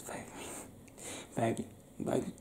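ordinary delivery

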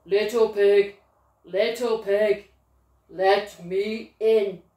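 a hint of a sly wolf calling in; wolf at the door.

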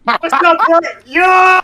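loud high greeting shout; calling out. Real, not acted. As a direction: yell across to a new arrival. Calling out to new player.

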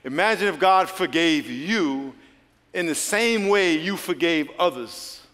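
slow it down and let the pause land. Shown as a demonstration. Preacher repeating his line slowly to the congregation. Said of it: "I'm gonna say it again slow, then I'm gonna pause for emphasis".

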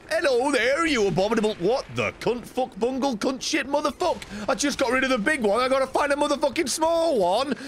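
high-pitched frantic cursing; worked-up rage at the game; exasperated gaming rant. Real, not acted. Raging at game enemies.

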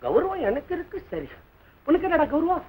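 normal talking voice

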